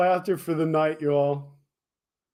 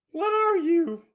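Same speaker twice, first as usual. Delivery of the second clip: normal talking voice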